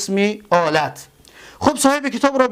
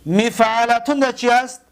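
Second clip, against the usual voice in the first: ordinary delivery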